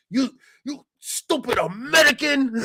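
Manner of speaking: high, loud, slow mock insult from an angry stranger; imitating angry stranger. Driver yelling in the speaker's face, mock furious high shout. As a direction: spit the words out like the furious driver. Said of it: "the guy's right in my face".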